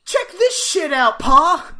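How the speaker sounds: high, loud mock-kid holler; Opie. Co-host parodying a boy calling his pa, excited holler. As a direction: proudly call Pa over, hammed-up. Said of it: "Opie says".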